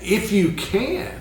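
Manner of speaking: If you can, high and slow, incredulous; Jesus echoing the father's words, astonished and exclaiming; with exclamation. Retold or imitated. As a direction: throw the father's "If you can" back in disbelief. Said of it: "he said it with exclamation".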